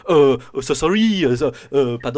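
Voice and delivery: put-on high, loud, over-the-top Thippian accent, apologising; exaggerated Thippian accent. Soldier bumping into acolyte, apologising in an exaggerated Thippian accent. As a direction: ham up a fawning Thippian accent. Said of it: "one might say over-exaggerated Thippian accent".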